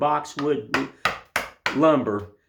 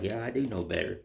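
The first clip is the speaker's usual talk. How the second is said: softer, lower sheepish admission; sheepish reply; 3 semitones lower, 7 dB quieter, faster than usual. Retold or imitated. [name] answering his own scolding, soft and sheepish. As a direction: mumble it like a caught kid. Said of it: "I'm like".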